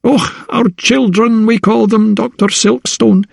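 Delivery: a fond Scots joke about his cargo; Scottish captain, grinning. Acted. Captain jokes to the doctor about the cargo, grinning Scottish brogue. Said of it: "he said with a grin".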